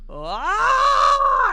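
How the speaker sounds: long high loud scream; imitated neighbor's yell. Player imitating the neighbor waking up, loud drawn-out yell. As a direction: mimic a neighbor's full-blast yell. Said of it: "my neighbor yelling and yelling".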